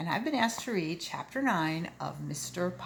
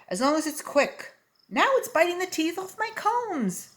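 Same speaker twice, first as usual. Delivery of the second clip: high, loud exasperated voice; rising panic about his combs; high, loud alarmed complaint; frantic barber; 12 semitones higher, 5 dB louder than usual. Acted.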